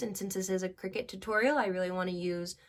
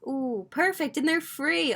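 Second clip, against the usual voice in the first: higher, louder happy burst; pleased to find them free; sudden excited ooh; delighted; 7 semitones higher, 7 dB louder than usual. Real, not acted. Finds free sports images.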